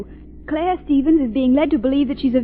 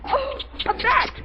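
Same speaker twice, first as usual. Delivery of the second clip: high panicked shriek; terrified cry; 8 semitones higher than usual. Acted. Margot begging Lamont to kill the bat, panicked. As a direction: scream at him to act, panicked.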